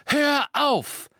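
frantic, loudest shout; yelling. Acted. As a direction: yell hör auf at full force. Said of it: "rief Harry".

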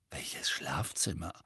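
slow whispered question. A narrator's reading. Harry asks as they pass the portrait of Sirius' mother, whispering. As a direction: ask it in a hushed whisper. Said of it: "fragte Harry mit Flüsterstimme".

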